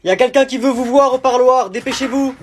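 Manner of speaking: loud, high barked summons; guard shouting down the corridor; prison guard call. Acted. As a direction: call the cell number like a guard. Prison guard calling inmate.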